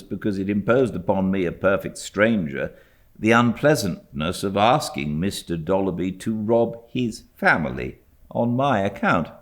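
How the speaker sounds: plain reading voice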